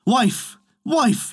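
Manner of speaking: high-pitched call to his wife. A narrator's reading. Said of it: "he called".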